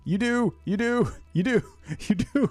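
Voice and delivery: high, sing-song repeated you do; half laughing, half panicking; giggly realisation; panicky laughing. Real, not acted. Discovering you can burn.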